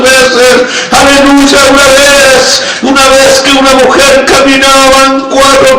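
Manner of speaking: sustained, intoned revival-preacher delivery; impassioned testimony punctuated by cries of praise; fervent preaching. Real, not acted. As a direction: testify with unbroken fervor.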